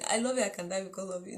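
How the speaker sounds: fast singsong rattle of the suitor's line; recited like a memory verse. Retold or imitated. Mocking the boy who courted her mother, fast recitation. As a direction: rush it out like a memorized Bible verse. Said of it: "as if it is memory verse".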